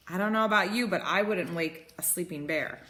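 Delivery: usual speaking voice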